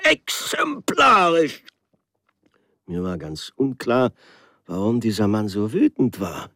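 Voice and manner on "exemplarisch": shrill, loud, rising outrage, stumbling over the word; railway man demanding punishment, furious and sputtering. Acted. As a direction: sputter it out in a towering rage. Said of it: "keuchte wütend".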